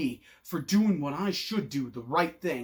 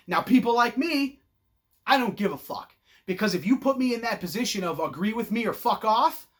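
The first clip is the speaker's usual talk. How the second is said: ordinary talk, nothing marked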